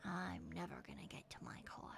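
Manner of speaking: breathless unvoiced whisper. Real, not acted. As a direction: mutter it in defeat. Despairing aside.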